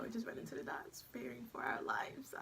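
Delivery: hushed, voice falling away; fearful whisper. Retold or imitated. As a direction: drop into a whisper as if scared. Recalls running in fear.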